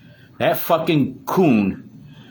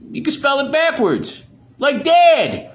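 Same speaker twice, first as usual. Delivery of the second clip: mocking yell; 13 semitones higher, 4 dB louder than usual. Real, not acted. Mocking Bob's name.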